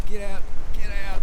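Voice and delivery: high, loud shooing cry; repeated high-pitched shooing; calling out. Real, not acted. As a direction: shout it high to drive them off. Chasing something away, calling out.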